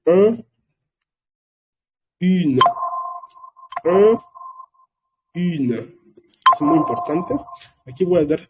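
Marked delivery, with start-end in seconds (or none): 0.0-5.8 Un and Une contrasted, a bit higher and louder; French un and une. Shown as a demonstration. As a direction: alternate Un and Une crisply for repetition. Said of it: "estoy pronunciando la U".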